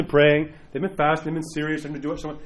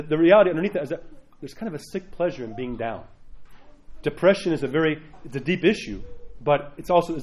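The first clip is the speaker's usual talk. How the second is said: ordinary talk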